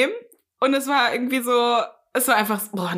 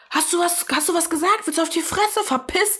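higher-pitched mock threat at normal volume; retold angry shouting; 6 semitones higher than usual. Imitating the huge man confronting the date, mild mock yell. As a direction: imitate an aggressive man picking a fight. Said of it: "schreit so".

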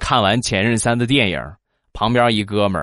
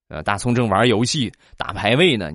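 normal talking voice

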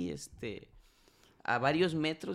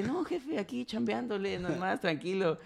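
much higher, put-on easygoing voice of the coconut seller; mock laid-back vendor; 6 semitones higher than usual. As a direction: play the guy acting innocent and chill. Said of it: "el tipo así bien tranquilo". (imitación burlona del vendedor tranquilo, voz mucho más aguda)

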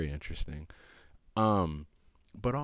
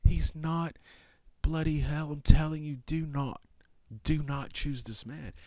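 much higher, louder forbidding command; voice of God; 10 semitones higher, 5 dB louder than usual. Retold or imitated. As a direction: thunder down the warning from on high. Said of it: "Sorry I did that".

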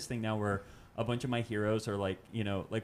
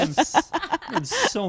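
laughing; 7 dB louder, slower than usual. Real, not acted. Sunburned, laughing.

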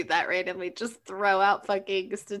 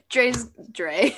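usual speaking voice

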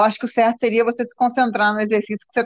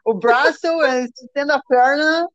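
mock American accent in Portuguese; slow, robotic app-voice Portuguese with American accent; 4 semitones higher than usual. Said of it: "português com sotaque americano". (sotaque americano imitado)

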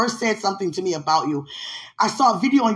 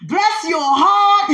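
mock sweet blessing; 9 semitones higher, 5 dB louder, much slower than usual. Real, not acted. Playful teasing of the listener.

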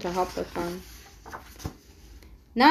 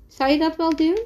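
higher, louder worried question; 8 semitones higher, 5 dB louder, faster than usual. A narrator's reading. Nina doubting her friend's visit, worried. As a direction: ask it with mild alarm, pitched up. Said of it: "vraagt Nina bezorgd".

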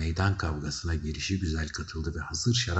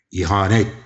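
loud cry of accusation; 3 semitones higher, 10 dB louder than usual. A narrator's reading. Archduke cries treason after the others, loudly. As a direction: call it out with force. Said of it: "diye haykırdı Arşides tekrar Veba".

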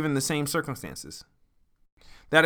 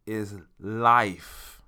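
"life" set apart after a pause, said lower and weighty; stress on life; 4 semitones lower than usual. Real, not acted. Host naming the topic for listeners, weighty stress on "life". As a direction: lean into "life" as the one word that matters. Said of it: "put so much emphasis on that word".

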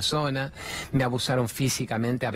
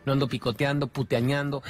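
ordinary talk

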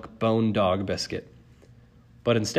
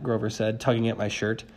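plain reading voice

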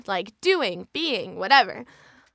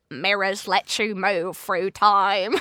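slow, lower British character voice; Colin Rittman; 4 semitones lower, slower than usual. Shown as a demonstration. Actor doing Colin from Bandersnatch, slow low voice. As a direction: draw it out, low and odd. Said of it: "Colin Rittman from Bandersnatch".

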